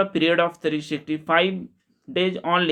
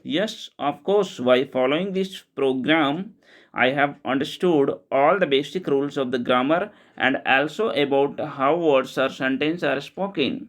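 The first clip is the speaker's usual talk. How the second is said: slow clear English reading; much slower than usual. Shown as a demonstration. Teacher reading the English version to learners, slow and deliberate. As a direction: read each English word slowly and distinctly, like dictation.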